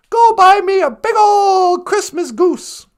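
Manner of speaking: loud, high, slow and drawn-out; exuberant shouted order. Acted. Scrooge sends boy for a goose, exuberant shout. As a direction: relish it, shouting down to the street.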